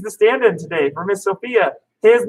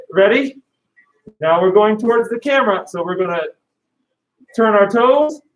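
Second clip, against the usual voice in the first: ordinary talk, nothing marked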